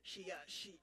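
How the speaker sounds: normal talking voice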